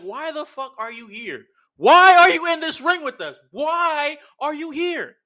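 the crowd demanding he leave the ring; mock crowd yell. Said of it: "it's like".

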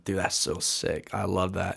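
laid-back surfer dude; slow, chill surfer-dude drawl. Retold or imitated. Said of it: "I totally sounded more like a surfer".

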